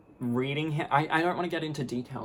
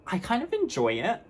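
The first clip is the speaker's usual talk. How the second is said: gleeful admission; 10 semitones higher than usual. Real, not acted. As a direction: admit it with a grin. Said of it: "I'm gonna be honest".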